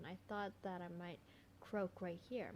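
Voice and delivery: ordinary delivery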